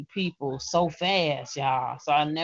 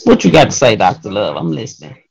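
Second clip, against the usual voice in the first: much louder eager call; 4 semitones lower, 15 dB louder than usual. Real, not acted. Host calling out to Doctor Love on the show, loud. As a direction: hype up the next poet.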